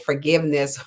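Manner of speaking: normal talking voice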